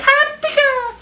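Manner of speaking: very high-pitched cartoon creature squeak; Pikachu. Likening someone's sneeze to a cartoon, very high squeaky imitation. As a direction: chirp it like a tiny cartoon critter. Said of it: "like fucking Pikachu".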